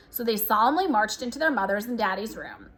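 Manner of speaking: usual speaking voice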